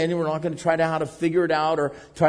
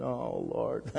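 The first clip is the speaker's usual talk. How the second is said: forlorn; 3 semitones lower, 9 dB quieter than usual. Retold or imitated. Discouraged like sulking kids.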